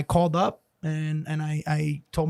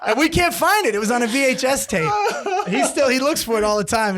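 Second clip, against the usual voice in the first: telling it through laughter, high and loud; cracking up mid-story; laughing; 7 semitones higher, 4 dB louder than usual. Real, not acted. Comic recalling the lost tape of his fight, laughing.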